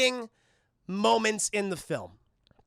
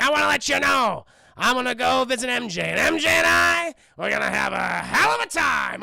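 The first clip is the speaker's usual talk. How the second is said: gloating villain taunt; 2 semitones higher, 5 dB louder than usual. Retold or imitated. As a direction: ham up the gloating supervillain. Green Goblin taunting, voiced by streamer.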